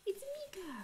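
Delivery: delighted squeal. Real, not acted. Spotting her friend in chat, high delighted squeal. Mika arrives.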